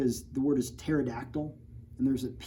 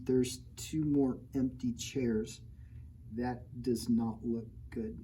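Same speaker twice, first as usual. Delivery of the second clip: slow and soft, ominous; deadpan grim aside; 4 dB quieter, much slower than usual. Real, not acted. Teacher noting the missing kids with mock dread. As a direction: let the dark joke sink in.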